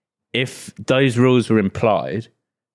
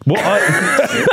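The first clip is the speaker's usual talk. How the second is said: laughing; 9 semitones higher than usual. Real, not acted. Caught out, laughing hard.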